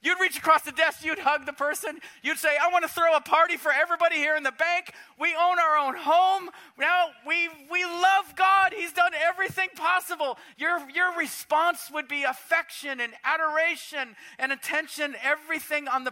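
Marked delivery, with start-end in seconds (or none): 2.6-10.4 loud, high, overjoyed; elated. Pretend. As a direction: gush with gratitude to the bankers. Imagined joyful reaction at bank.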